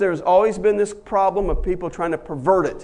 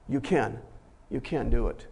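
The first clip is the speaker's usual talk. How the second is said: soft encouragement; 8 semitones lower, 9 dB quieter than usual. Real, not acted.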